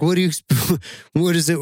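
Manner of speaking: high, puzzled mock question with a laugh; surprised disbelief. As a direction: play the friend caught off guard. Friend's confused reply quoted.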